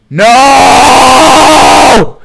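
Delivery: loud high scream of no; screaming no as he falls; anguished shout. Real, not acted. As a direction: scream it out in despair. Reacting to falling.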